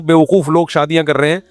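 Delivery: ordinary talk, nothing marked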